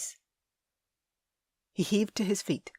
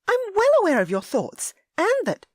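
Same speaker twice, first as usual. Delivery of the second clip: high, loud indignant lady; Ellie, furious; 8 dB louder than usual. A narrator's reading. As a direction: cut him off in a rage.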